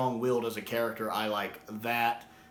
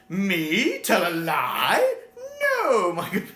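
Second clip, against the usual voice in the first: high, loud wide-eyed innocent voice with a laugh; giggling fake innocence; mock-innocent Doctor; 8 semitones higher, 7 dB louder, much slower than usual. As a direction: play the innocent child caught in a fib, laughing.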